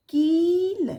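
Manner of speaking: कील with a high, loud, long ee; stretched long vowel. Shown as a demonstration. Teacher models a long i word, stretched vowel. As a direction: draw out the ee in कील before the l. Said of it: "थोड़ा बढ़ा करके बोलेंगे".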